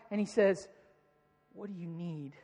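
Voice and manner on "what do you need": much softer, tender asking; gentle question. Retold or imitated. Jesus kneels to the two blind men, soft tender question. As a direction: ask it gently, face to face.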